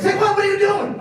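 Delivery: high, loud mock yell; manager's startled yell. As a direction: catch him out with a shrill shout. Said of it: "my other manager... say".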